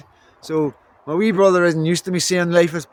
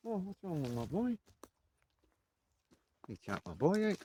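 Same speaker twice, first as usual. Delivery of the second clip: much softer, tender coo to the dog; soft pet talk; 4 semitones lower, 13 dB quieter than usual. Real, not acted. Owner fussing over his ageing dog, soft tender coo.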